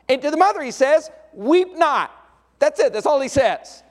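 preacher builds to a high, loud peak; preaching at full force. Acted. Preacher telling the church about the grieving mother, full-force preaching. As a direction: hammer the moment home to the congregation.

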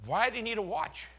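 the Lord answering his prayer about the watch, gently. Retold or imitated. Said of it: "it's like, he".

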